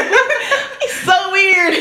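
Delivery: high, drawn-out laughing exclamation; laughing disbelief. Retold or imitated. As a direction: stretch it out slowly through loud giggles. Laughing at her odd behaviour.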